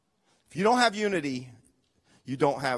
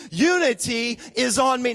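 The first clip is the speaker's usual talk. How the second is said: loud, forceful congregation refrain; said like you mean it; 2 semitones higher, 5 dB louder than usual. Shown as a demonstration. Pastor leading the church in a shouted refrain. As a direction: shout it out with conviction. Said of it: "Say it like you actually mean it".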